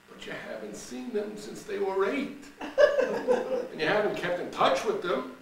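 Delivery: high, giggling objection; laughing tease. Acted. Cheryl laughing at Laverne's old friends, voice high. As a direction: tease her through laughter.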